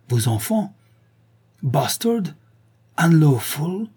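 rising, incredulous questions; scornful taunt. A narrator's reading. Pepa answers his cry with insults.

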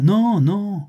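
high, loud, exasperated no; impatient refusal. A narrator's reading. Commandant impatiently refusing the concierge's questions.

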